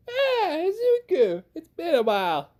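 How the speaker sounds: high-pitched, half-hearted Southern belle greeting; attempted Southern accent. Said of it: "I'm not good with a southern accent".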